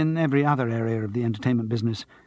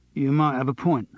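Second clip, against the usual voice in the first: unimpressed; 2 semitones higher than usual. Acted. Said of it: "didn't sound too bowled over".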